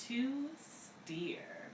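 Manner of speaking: soft unvoiced finish; whispered ending. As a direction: whisper the last words. Playful hushed ending.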